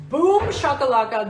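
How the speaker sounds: loud, high boom shakalaka; hype shout. Pretend. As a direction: sell it like a hype man. Hyping expensive crystal.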